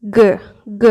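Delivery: গ sounded like Bengali g; demonstrated Korean g. Shown as a demonstration. Teacher showing learners the Korean letter, demonstrated g. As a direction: say গ as a short, separate g sound. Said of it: "এরম উচ্চারণ হয়".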